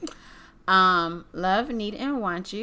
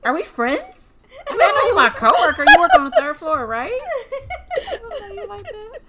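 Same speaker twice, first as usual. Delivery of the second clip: higher, louder, laughing brushoff; mock awkward coworker; 14 semitones higher, 10 dB louder than usual. Coworker rebuffing a crush, high laughing brushoff. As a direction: play the clueless coworker.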